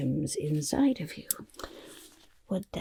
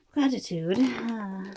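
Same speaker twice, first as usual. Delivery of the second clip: ordinary delivery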